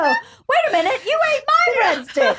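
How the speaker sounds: high laughing accusation; yelling across the room. Retold or imitated. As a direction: squeal it in mock outrage.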